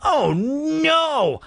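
high, loud cry of fright; startled cry. Acted. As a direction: cry out in shock. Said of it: "with a startled cry".

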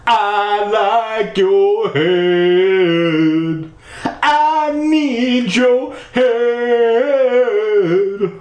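slow, high, sung ghost voice; spooky singsong ghost. Acted. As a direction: croon it like a creepy ghost.

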